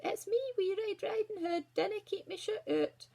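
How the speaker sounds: put-on high little-girl voice; wee girl. A narrator's reading. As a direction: pipe up as a small girl.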